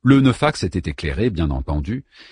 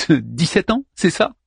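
mocking; 8 semitones higher than usual. Daeman mocking Anna.

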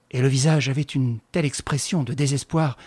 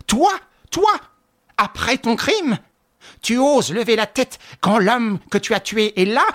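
much higher, louder outraged accusation; screaming accusation; furious attack; 9 semitones higher, 5 dB louder than usual. A narrator's reading. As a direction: attack her with every word. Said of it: "reprit l'attaque, les poings tendus".

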